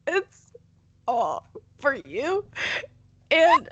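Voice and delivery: high, whiny fake-crying voice; mock tearful plea. Host guilt-tripping listeners for appreciation, mock tearful. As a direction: plead in a hammed-up, high wail.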